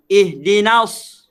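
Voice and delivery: "Ihdinas" slow with a thick, heavy s; heavy sod. Shown as a demonstration. Ustaz shows the sod sound in "Ihdinas". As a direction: make the s in "Ihdinas" full and heavy. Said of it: "Dia huruf sod".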